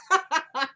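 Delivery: high, loud put-on laugh; mock scoffing laugh. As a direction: imitate a mother's dismissive laugh. Acts out mother's scoffing laugh.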